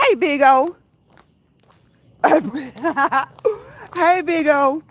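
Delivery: loud, high happy hello to a friend; excited greeting. Real, not acted. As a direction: call out a warm, delighted hello.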